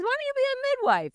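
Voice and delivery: high, quick, breezy friend voice; friend's suggestion. Retold or imitated. Friend suggesting a new career, said high, quick and breezy. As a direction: toss the idea off lightly, up high. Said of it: "She goes".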